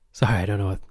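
ordinary talk, nothing marked

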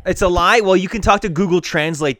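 loud, high incredulous retort, slowing on the insult; indignant comeback. Real, not acted. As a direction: throw the accusation back hotly. Bickering after being called a liar.

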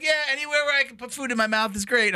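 high, lighter voice for the wife. Retold or imitated. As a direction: voice the wife's cheerful reply. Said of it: "she's like".

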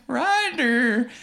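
zombie sister. Retold or imitated. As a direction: call the name like an undead sister. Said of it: "starts trying to hug him, going".

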